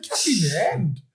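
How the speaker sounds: disbelieving, questioning repeat; incredulous echo. Retold or imitated. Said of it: "I was like".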